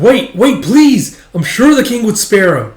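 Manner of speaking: high, loud begging; urgent pleading. Acted. Yun pleads for condemned friend's life, urgent.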